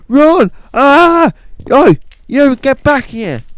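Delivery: high, very loud chase shouts; yelling. Acted. Policeman spotting the bank robber and giving chase, high yelling. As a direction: scream and yell as the chase takes off.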